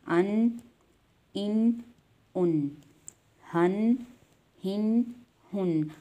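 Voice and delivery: each syllable alone with pauses, अन इन उन हन हिन हुन; recited sounds. Shown as a demonstration. Teacher drills students on the sounds, recitation. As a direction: clear slow drill of the nasal endings. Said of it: "teacher recites sounds for students".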